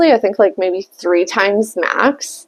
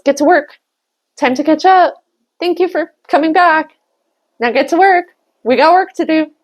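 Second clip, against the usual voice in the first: higher, brisk orders; bossy coworker; 6 semitones higher than usual. Retold or imitated. Coworker handing her papers, bossy orders. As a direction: hand out work like a pushy coworker.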